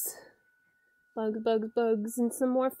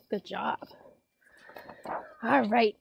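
ordinary delivery

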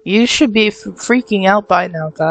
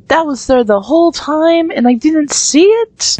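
surprised; 9 semitones higher than usual. Real, not acted. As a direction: realise it was there all along. Spotting something missed.

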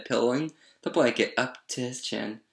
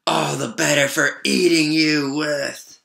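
loud, slow, higher wolf roar; menacing wolf; 4 semitones higher, 6 dB louder, slower than usual. A narrator's reading. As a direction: boom it out, drawing out every word. Wolf reveals himself, pounces.

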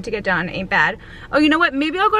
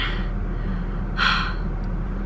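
heavy sigh; 6 dB quieter than usual. Real, not acted. Sore after workout class.

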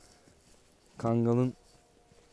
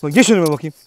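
loud order; 3 semitones higher, 10 dB louder than usual. Real, not acted. Ordering dog to walk ahead.

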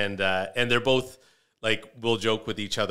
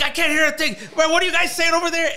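much higher, much louder, fast shouting; dad yelling; 11 dB louder, faster than usual. Retold or imitated. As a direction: bellow over a blaring TV like a half-deaf dad. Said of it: "my dad's yelling over the television".

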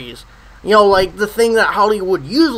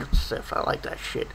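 a hushed whisper, like a secret; whispered aside; 12 dB quieter than usual. Real, not acted. Admitting to viewers he likes Revenge of the Sith, whispered. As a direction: confess it under your breath.

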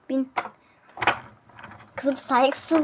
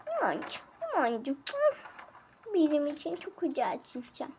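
soft, hushed loving coo; mother cooing to her daughter; tender cooing; 11 dB quieter, much faster than usual. Acted. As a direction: murmur sweet names to your little girl.

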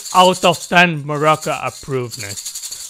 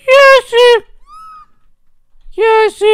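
very high squealed yes; high-pitched victory yelp; triumphant cry; 8 dB louder than usual. Real, not acted. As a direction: squeal it in celebration. Celebrates a success in the game.

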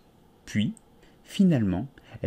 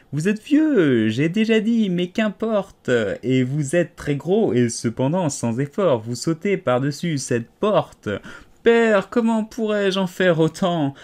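higher, louder sing-song recitation; poem recitation; 4 semitones higher, 4 dB louder than usual. A narrator's reading. Alice recites "Vous êtes vieux, père William" to the caterpillar. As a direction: recite it like a schoolgirl.

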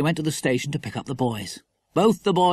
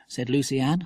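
usual speaking voice

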